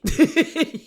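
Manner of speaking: short laugh, pitched high; real laugh in the middle of the retelling. Real, not acted. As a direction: laugh at the insult. Laughs after quote.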